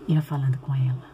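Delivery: sly whispered echo; whispering. Acted. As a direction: breathe it like a whisper. Echoing serpent's whisper. (sussurro insinuante)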